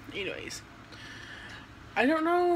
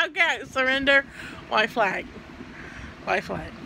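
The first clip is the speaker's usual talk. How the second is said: being tickled; 2 semitones higher, 5 dB louder than usual. Real, not acted.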